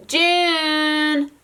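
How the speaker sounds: high, drawn-out "June, June"; toddler calling out. Retold or imitated. Mom imitates her daughter calling her sister, high and drawn out. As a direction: call out long and high like a toddler. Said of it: "like, calling for Violet".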